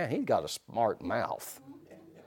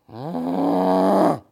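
short closed-mouth warning hum; a bull elephant's warning grunt; mock elephant grunt; 7 semitones higher, 6 dB louder than usual. Preacher imitates the bull elephant warning, mock grunt. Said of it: "and he'll go".

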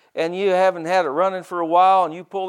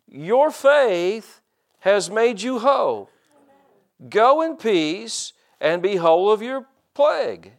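slow solemn reading; 2 semitones higher, slower than usual. Retold or imitated. Reading Jesus' words solemnly.

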